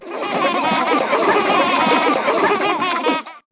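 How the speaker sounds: high, loud jeering laugh; mocking kids' laughter. Schoolkids laughing at striped Camilla, loud mocking laughter. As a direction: cackle like a mean classmate.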